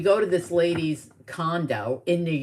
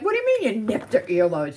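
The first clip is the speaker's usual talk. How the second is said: retold disbelief; 5 semitones higher, 4 dB louder than usual. Child questioning mom in retold story.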